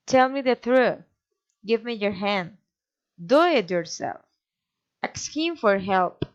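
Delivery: commands dropping at the end, as on TRUTH and HAND; firm demo tune falling to HELP. Shown as a demonstration. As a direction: let YOURSELF and HELP fall away. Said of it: "Commands falling intonation".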